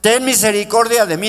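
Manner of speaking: desperate call for mercy; pleading cry. Retold or imitated. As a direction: cry out to the Lord in need. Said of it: "a gritarle al Señor".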